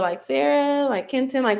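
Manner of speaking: normal talking voice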